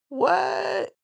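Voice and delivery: high, baffled what; stunned by the chat comment; startled what. Real, not acted. Baffled streamer reacting to chat about her voice. Confused by chat saying voice wrong.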